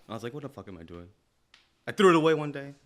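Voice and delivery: usual speaking voice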